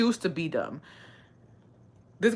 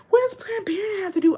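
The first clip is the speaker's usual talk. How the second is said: high, fast, incredulous squeal; stunned reply to sexist commenters, pitched way up; baffled outrage; faster than usual. Retold or imitated. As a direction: shriek your disbelief at the idea.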